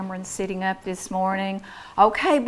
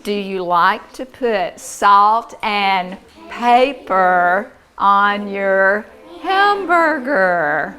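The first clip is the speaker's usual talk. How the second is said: model sentence; 7 dB louder, much slower than usual. Shown as a demonstration. Teacher putting the new word in a sentence, slow and lilting. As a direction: spread the words out, singsong at the end. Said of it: "Let's put that word in a sentence".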